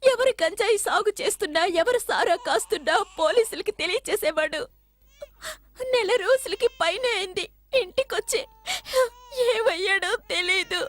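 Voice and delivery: crying through the account; tearful. Acted. Woman telling police her man is missing, tearful. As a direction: plead through tears, catching the breath.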